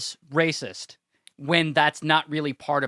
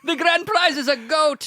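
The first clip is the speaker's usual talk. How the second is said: higher, laughing put-on Polish accent; 11 semitones higher than usual. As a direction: laugh through a mock Polish announcement. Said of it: "I can't do a Polish accent".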